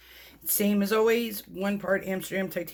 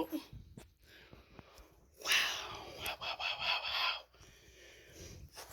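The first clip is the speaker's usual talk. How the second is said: much softer, hushed; awed whispers; 14 dB quieter, much slower than usual. Real, not acted. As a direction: whisper it in wonder. Staring at close-up.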